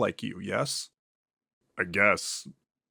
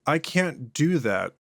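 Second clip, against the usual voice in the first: ordinary talk, nothing marked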